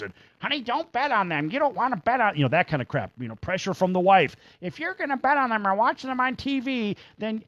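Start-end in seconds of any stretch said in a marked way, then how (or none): 0.4-2.4 high, nagging wife voice; wife impression. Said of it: "Not a bad impression of the wife". 4.6-7.4 high, nagging wife voice; wife impression. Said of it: "Not a bad impression of the wife".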